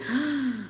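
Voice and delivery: sharp high gasp of disbelief; shocked gasp. Real, not acted. Hearing beggars make four hundred a day, a gasp. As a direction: gasp at the number.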